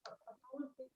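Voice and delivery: muffled bad mic audio. Real, not acted. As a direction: voice muffled as if covered. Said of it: "[name], you're kinda muffled".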